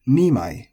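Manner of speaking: nimai high on ni, falling on mai; accent on NI. Shown as a demonstration. Teacher demonstrating the counter, nimai accented on ni. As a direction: hit the ni in nimai, then drop. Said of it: "with the accent on the ni".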